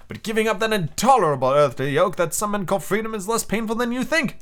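calm authority dismissing earthly freedom. Acted. Commander voiced in read story.

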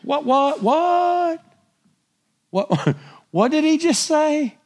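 high-pitched, incredulous "what"; mock disbelief, voice shooting high; astonished double take. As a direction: replay a stunned reaction, pitch leaping up. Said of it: "I'm reading this and I went".

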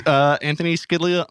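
ordinary delivery

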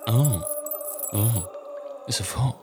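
ordinary talk

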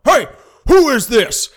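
loud, high demand from the background; dad cutting in on the call, loudly demanding who is on the line; angry dad. Retold or imitated. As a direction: bark it like a furious dad catching them. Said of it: "you hear this voice on the background going".

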